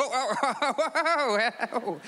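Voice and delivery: high, sliding whoa whoa on a jolt; thrilled yelp at a sudden drop; startled whoas. Retold or imitated. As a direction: ride the bump with amused alarm. Turbulence bump, playful alarm.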